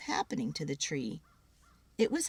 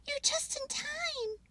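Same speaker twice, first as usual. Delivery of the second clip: high squirrel voice, softer; hurried little squirrel; 4 dB quieter than usual. Acted. Pip squirrel character voice.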